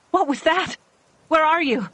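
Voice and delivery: high, loud scared calls; frightened calling. A narrator's reading. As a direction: call out into the dark.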